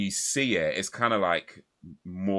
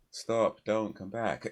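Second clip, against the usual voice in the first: half-hearted, higher-pitched mock warning; nonchalant; 4 semitones higher, 4 dB quieter, slower than usual. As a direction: imitate a token protest with no urgency. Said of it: "nonchalant about everything".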